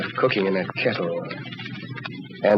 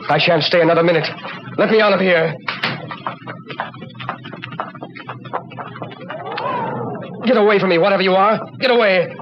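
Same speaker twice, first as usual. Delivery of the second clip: panic; 8 semitones higher than usual. Acted. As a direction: build from a frantic plea into shrieking terror. Trapped in the dark with a spirit.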